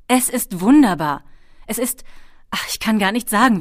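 loud, lifted burst of wonder; overwhelmed delight. A narrator's reading. Ben on his first dragon flight, overwhelmed with delight. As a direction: exclaim it, then rush on, lost for words.

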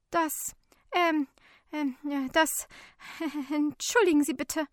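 high, stammering, nervous apology; flustered mumbling. A narrator's reading. Woman learns helper is police, stammers an embarrassed apology. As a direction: stumble through it, suddenly meek. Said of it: "murmelt sie".

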